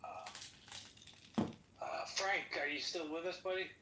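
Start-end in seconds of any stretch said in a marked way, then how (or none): none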